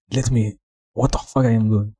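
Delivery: ordinary talk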